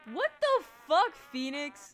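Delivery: loud, high startled outburst; shocked yell. Real, not acted. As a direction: yell it in disbelief. Shocked by a raid.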